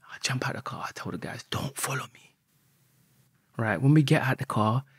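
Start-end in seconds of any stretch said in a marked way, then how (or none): 0.1-2.3 hushed whisper, barely voiced. Whispering to the guys as he jumps out of the car. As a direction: whisper it so nobody hears.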